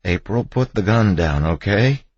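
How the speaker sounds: urging. A narrator's reading. Husband urging armed wife to disarm.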